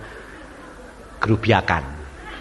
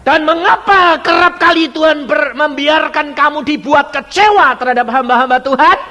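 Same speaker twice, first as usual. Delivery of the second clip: high, loud preaching; 12 semitones higher, 4 dB louder than usual. Real, not acted. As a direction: drive the point home to the congregation. Preaching at full force. (khotbah dengan suara tinggi dan keras)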